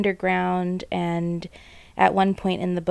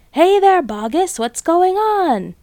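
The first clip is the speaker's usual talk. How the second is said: curious crowd shout; 10 semitones higher, 6 dB louder, slower than usual. A narrator's reading. Onlookers calling down into crater.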